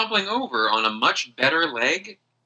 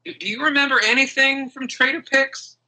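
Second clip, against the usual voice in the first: Aggie voice; 10 semitones higher than usual. Acted. Aggie NPC asking.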